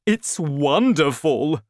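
drawn-out, delighted praise; gleeful, smiling. A narrator's reading. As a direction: relish it with a big smile. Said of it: "with an enormous smile on his face".

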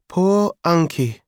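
put-on high, slow toddler voice; small child sighing. A narrator's reading. Emma pities her uncle, slow childish sigh. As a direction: sigh it sympathetically like a little child. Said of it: "Emma sighed".

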